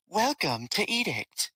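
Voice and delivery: high, smooth computer announcement voice; like a polite station AI; automated female voice. Acted. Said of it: "a familiar feminine automated voice".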